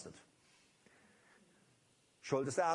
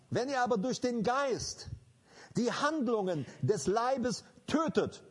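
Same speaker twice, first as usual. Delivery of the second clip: forceful preaching; 6 semitones higher than usual. Acted. Preaching the verse.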